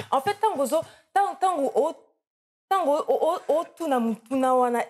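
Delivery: high, loud mock indignation in Lingala; excited Lingala outburst. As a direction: fire off a teasing rant in Lingala. Interviewer mocks in Lingala, laughing.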